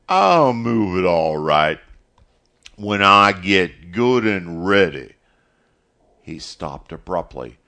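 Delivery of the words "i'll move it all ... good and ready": loud, drawn-out angry retort; stubborn refusal, slow and loud; defiant. A narrator's reading. Caleb refusing to move his truck, loud defiance. Caleb defies Mister Morrison.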